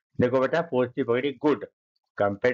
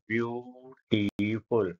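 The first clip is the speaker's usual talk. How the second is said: slow syllable demo; 3 semitones lower, 4 dB quieter, much slower than usual. Shown as a demonstration. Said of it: "इसमें तीन शब्दांश syllable है".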